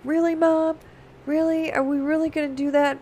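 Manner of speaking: put-on a kid who can't believe it's true; hopeful little boy. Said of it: "be like".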